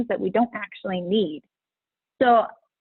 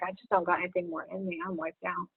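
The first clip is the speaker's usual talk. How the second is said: exhausted; 3 semitones lower, 10 dB quieter than usual. Retold or imitated. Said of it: "you're like".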